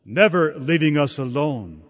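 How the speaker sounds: ordinary talk, nothing marked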